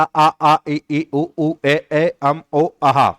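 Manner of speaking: vowels sung out open, tongue touching nothing; teacher demonstrating vowel sounds; vowel demo. Shown as a demonstration. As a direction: voice each vowel straight from the throat. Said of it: "कौन सा?".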